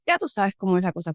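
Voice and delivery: ordinary talk, nothing marked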